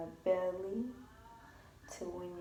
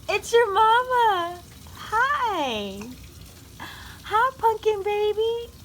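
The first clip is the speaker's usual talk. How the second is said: high calling voice; 11 semitones higher, 14 dB louder, much slower than usual. Real, not acted. Calling baby to get attention.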